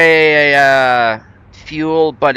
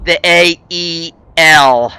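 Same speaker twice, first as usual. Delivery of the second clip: "The A E L" spelled slowly, each letter stressed and a bit louder; 4 semitones higher, 6 dB louder, slower than usual. Shown as a demonstration. Streamer spells out his name for chat, slowly and letter by letter. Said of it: "'Cause it's spelled with an A".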